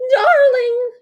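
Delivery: very high, loud frantic cry. A narrator's reading. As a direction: shriek for her daughter. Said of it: "cried the Duchess".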